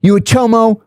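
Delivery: retold interrogation. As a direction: fire an accusing question at the newcomer. Inmate interrogates newcomer.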